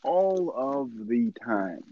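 emphatic slow. Real, not acted. Podcaster confirming how often Spanish people say it, slowly emphatic. Emphatic echo of cohost's agreement.

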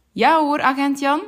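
a hint of a friendly farmer's wife. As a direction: answer the policeman brightly from the yard. Farmer's wife answers policeman.

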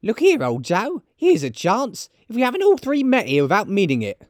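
very high-pitched woman's character voice; high, forward charwoman; bold charwoman. Acted. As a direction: pipe up high, addressing the company.

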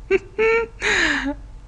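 high-pitched laugh; real high laugh. Real, not acted. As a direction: burst into a high giggle at your own story. Laughing at her own story.

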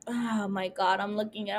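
ordinary delivery

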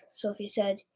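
plain reading voice

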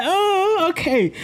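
high mock-girl "oh"; playful high-voiced imitation; imagined girl's reply.

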